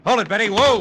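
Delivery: loud sharp call to halt; shout to stop her. Acted. Detective shouting at Betty as she runs past, loud command. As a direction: bark it out to stop her in her tracks. Stopping fleeing woman in hallway.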